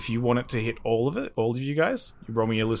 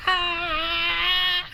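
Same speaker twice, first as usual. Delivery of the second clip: very high, sustained mock scream; hammed-up falling scream; constant screaming. Game master voicing Gimbal falling, long high scream. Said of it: "constant screaming from".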